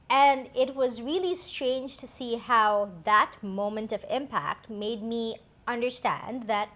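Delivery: ordinary delivery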